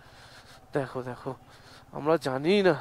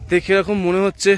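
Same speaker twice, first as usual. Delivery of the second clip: ordinary talk, nothing marked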